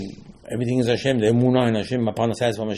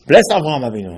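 louder, declaiming the blessing; proclaimed blessing; 6 dB louder than usual. Retold or imitated. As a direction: call it out like a proclamation. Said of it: "and he said".

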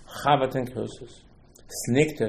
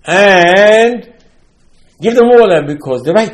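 booming order, pitched up; commanding loud; 6 semitones higher, 13 dB louder, slower than usual. Retold or imitated. Hashem ordering Moshe to give water, booming. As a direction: call it out with authority.

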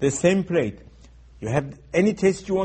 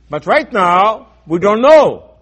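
forceful preaching; 4 semitones higher, 11 dB louder, slower than usual. Real, not acted. Preacher stressing uncertainty.

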